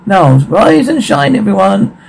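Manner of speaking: cheery wake-up call. Acted. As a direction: call them up brightly like a morning host.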